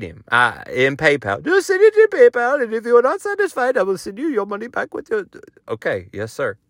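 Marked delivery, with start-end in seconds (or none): 1.4-5.2 high-pitched put-on salesman voice; high, strained character voice, stretched at the end; tech guy Sushel. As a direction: pitch it way up as the Instagram promoter. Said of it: "I paid Sushel".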